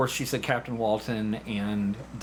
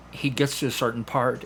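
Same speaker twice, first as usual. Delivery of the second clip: ordinary talk, nothing marked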